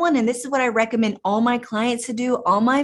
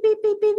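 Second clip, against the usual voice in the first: high, beeping radar imitation; mock radar beeps; 10 semitones higher, faster than usual. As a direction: beep it out like an alarm. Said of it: "my drama radar is like beep".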